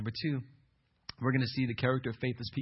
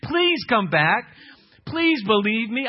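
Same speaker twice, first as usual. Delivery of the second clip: higher, louder, slow begging, hammed-up; mock pleading; 8 semitones higher, 7 dB louder, slower than usual. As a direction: beg them back in a mock whine. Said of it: "call anybody and say".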